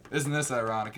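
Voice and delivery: loud, astonished mock captain; hammed-up loud surprise; Vince Vaughn. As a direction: play an officer eating his words, loudly. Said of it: "he comes over to Vince Vaughn".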